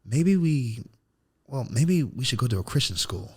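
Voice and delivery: put-on slightly high, timid little-boy voice; meek kid suggestion. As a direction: suggest it shyly like a small child. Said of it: "I said".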